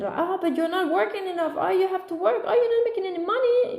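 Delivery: high, sing-song mock nagging; mocking critics. Mom mimicking people who judge her work, mocking nag.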